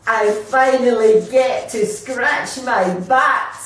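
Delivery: loud, slow triumphant relief. Acted. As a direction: savour the long-awaited scratch. Relieved final scratch.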